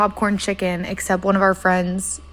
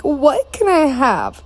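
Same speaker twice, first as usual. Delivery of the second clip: slow, high exasperated plea; 6 semitones higher, slower than usual. Retold or imitated. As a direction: plead in exasperation. Exasperated asking what is allowed.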